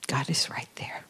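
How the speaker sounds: God is right there, whispered low. Real, not acted. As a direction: breathe it like a secret comfort. Quiet reassurance in sermon.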